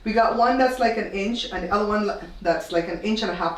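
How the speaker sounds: ordinary talk, nothing marked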